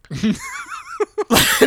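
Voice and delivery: high, breathy burst of real laughter. Real, not acted. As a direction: crack up helplessly. Losing it over the toilet request.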